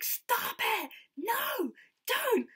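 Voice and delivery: very high, loud protesting wail; mock-horrified shriek. Star begging a fan not to get tattooed, mock-horrified shriek.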